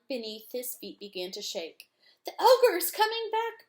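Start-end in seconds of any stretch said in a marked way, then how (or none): 2.2-3.7 high, loud alarmed shout; frightened yell. Acted. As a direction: warn everyone in a panic. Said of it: "Breen yelled".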